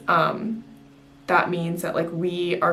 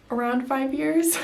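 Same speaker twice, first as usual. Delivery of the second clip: laughing; 8 semitones higher, 5 dB louder than usual. Real, not acted. Laughs about five-year degree.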